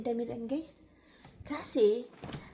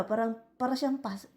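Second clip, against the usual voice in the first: ordinary talk, nothing marked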